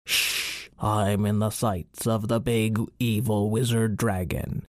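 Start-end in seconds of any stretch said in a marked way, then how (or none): 0.0-0.7 hushing whisper. Acted. Hiding from the wizard dragon.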